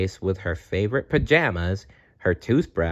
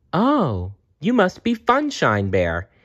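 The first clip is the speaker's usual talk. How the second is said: put-on higher, delighted little girl; excited cry; 8 semitones higher than usual. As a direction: gasp it out in happy surprise. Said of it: "Jenny cried".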